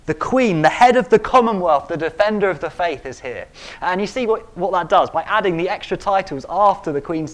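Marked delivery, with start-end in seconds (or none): 0.0-3.5 grand herald. Shown as a demonstration. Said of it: "or I could say".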